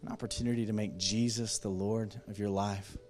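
preacher leading a salvation call; altar call. Acted. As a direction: invite them gently, unhurried.

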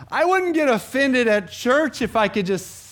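high, loud, slow grievance; mock complaint. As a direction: act out a churchgoer's hammed-up excuse. Voicing an imagined complaining listener.

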